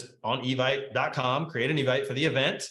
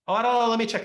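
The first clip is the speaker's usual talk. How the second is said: higher, louder flustered reply; imitating an unsure client; 9 semitones higher, 4 dB louder, faster than usual. Client asked about the email, flustered and unsure. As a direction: play the client caught off guard.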